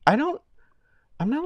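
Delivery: usual speaking voice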